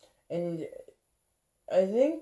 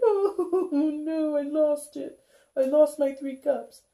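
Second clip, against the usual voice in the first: high, loud hammed-up wail; mock crying; 13 semitones higher, 5 dB louder, slower than usual. Tarot reader mocking sad viewers who lost their cups, hammed-up crying. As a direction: play the loss of the cups as a big fake sob. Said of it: "Others of you are sad about it".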